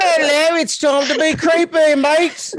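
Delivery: loud, high mock cockney accent; hammed-up Mary Poppins cockney.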